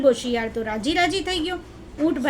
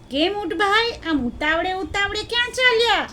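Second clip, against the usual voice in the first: higher-pitched inquisitive jackal; curious jackal; 7 semitones higher than usual. Acted. Jackal asks hurrying camel where he is going, curious. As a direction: ask it high, with storybook curiosity.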